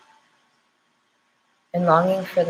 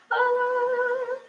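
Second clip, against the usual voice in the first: a high sung "ah"; sung note; 4 dB quieter than usual. Real, not acted. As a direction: sing a bright high ah. Shout-out to starseeds.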